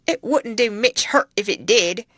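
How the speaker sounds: loud broad Yorkshire yokel voice; vulgar Yorkshire accent. A narrator's reading. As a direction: play the coarse stable lad sneering. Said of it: "He answered in his vulgar accent".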